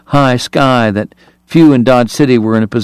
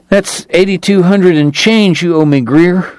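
higher, mildly hard demand; 5 semitones higher than usual. A narrator's reading. Winner telling Greer what he owes, mildly hard. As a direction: state the debt with a touch of hardness. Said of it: "he said, his voice hard".

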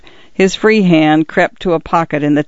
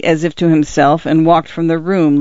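ordinary delivery, nothing marked

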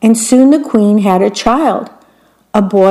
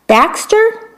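queen guessing; 12 semitones higher than usual. Acted.

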